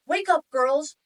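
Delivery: booming wake-up call. Retold or imitated. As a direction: boom it out to wake the girls. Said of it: "my dad boomed".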